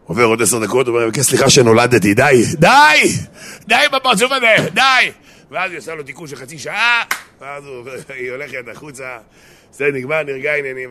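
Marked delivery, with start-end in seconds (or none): 1.1-5.1 loud apology climbing to high yells; exasperated shouting. Acted. Husband begging wife to stop sulking, exasperated shout. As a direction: burst out fed up, pitch leaping.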